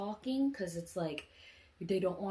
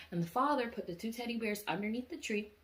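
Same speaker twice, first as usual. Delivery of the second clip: ordinary delivery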